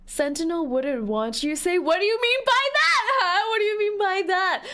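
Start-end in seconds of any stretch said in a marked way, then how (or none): 1.8-4.6 high, raised-pitch offended challenge; mock indignation. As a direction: play affronted, demanding an explanation. Mock indignant reply to chat.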